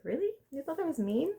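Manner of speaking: half-acted disbelief at his answer; shocked. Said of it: "she was, like, shocked".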